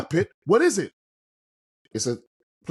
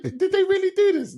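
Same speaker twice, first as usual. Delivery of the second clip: high, squeaky laugh through the words; laughing disbelief. Retold or imitated. Laughing disbelief at old film.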